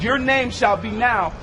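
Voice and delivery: ordinary talk, nothing marked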